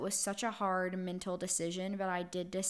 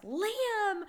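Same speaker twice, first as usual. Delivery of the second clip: put-on sharp, much higher cry of the baby's name; startled yelp; 13 semitones higher, 4 dB louder than usual. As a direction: yelp his name in sudden pain and alarm. Baby bites mother while nursing.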